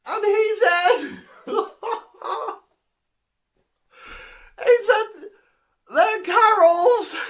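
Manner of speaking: high, loud, slow words squeezed through laughter; cracking up on the punchline; real helpless laughter. Real, not acted. Teller cracks up at own punchline.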